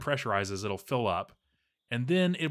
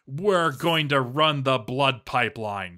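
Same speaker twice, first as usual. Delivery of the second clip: louder, slow and gleeful build-up; excited announcement; 3 semitones higher, 7 dB louder, slower than usual. Acted. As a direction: announce it like a grand, giddy reveal. Gleeful announcement of blood pipeline.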